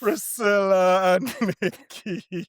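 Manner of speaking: high, drawn-out introduction of the guests; guest announcement. Acted. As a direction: stretch the names out like a show host. Host announces the two guests.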